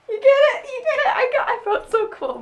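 high, loud and delighted, asking twice; bursting with pride in a cheesy rap; excited. Real, not acted. Fan asks the camera after rapping, giddy and excited.

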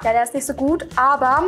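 ordinary talk

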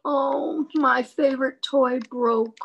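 hammed-up sad wail; mock sad cry. Shown as a demonstration. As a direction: play a crying child.

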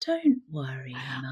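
whispered, soothing yet sinister; creepy whisper. Retold or imitated. Host imitates daughter calming mom mid-beating, creepy whisper. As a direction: soothe the victim like a psychopath. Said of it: "hitting you and going".

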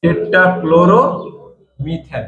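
টেট্রাক্লোরোমিথেন drawn out very slowly, syllable by syllable; slow spelled-out name. Shown as a demonstration. Teacher to class, slowly sounding out টেট্রাক্লোরোমিথেন. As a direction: stretch টেট্রাক্লোরোমিথেন out so students can copy it. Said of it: "মানে হচ্ছে টেট্রাক্লোরোমিথেন।".

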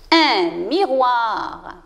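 "un miroir" stretched out slowly; slow word model. Shown as a demonstration. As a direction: model each sound slowly for pupils. Teacher models a new word. (mot modèle, prononcé très lentement)

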